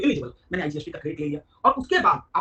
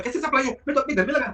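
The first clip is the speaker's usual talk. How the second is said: indignant protest; 7 semitones higher, 6 dB louder than usual. Retold or imitated. Shopkeeper protests to GST officer.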